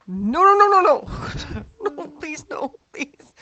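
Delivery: high, hammed-up pleading no; mock despair, begging them to stop; anguished denial.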